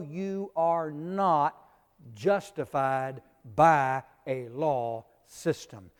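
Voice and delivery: slow, deliberate, each word set apart; slow emphatic reading. Shown as a demonstration. Preacher reading the verse to his class, slow and weighty. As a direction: read the verse as a firm verdict. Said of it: "Romans three verse twenty says".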